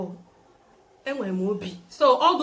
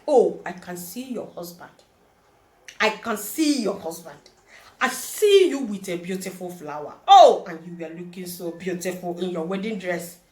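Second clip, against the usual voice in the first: lower, lightly voiced pastor prophesying; a hint of a pastor seeing visions, louder at the end; mock prophet voice; 4 semitones lower, 3 dB louder than usual. Pastor prophesying to a visiting girl, mock solemn.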